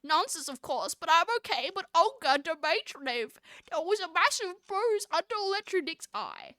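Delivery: hammed-up nerd character. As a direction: do a nerdy boy explaining himself. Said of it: "like, the nerd voice".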